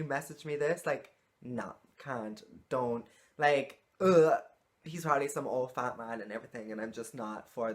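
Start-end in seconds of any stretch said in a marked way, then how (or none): 3.4-4.4 loud groan of disgust; loud disgusted ugh. Real, not acted. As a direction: groan it out, repulsed.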